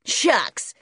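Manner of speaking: put-on loud, high boyish exclamation; put-out American boy. A narrator's reading. Junior rebuffed by George, loudly put out. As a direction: toss it out as a brash kid.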